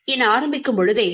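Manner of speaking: ordinary delivery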